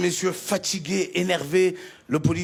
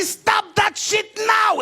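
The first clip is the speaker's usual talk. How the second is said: furious order; 11 semitones higher, slower than usual. Retold or imitated. Officer loses patience, slow high angry shout. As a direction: bark it, slowly. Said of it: "Il m'a dit".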